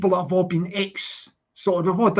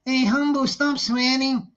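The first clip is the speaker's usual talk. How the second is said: put-on Scouse accent; 4 semitones higher than usual. Streamer mimicking Expo telling Humble off, Scouse accent. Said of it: "That sounds Scouse".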